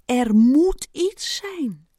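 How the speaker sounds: slow, loud stretch on "moet"; persistent insistence. A narrator's reading. Ant muttering on as he paces, dogged insistence. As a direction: start firm before fading away. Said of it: "maar wel steeds zachter".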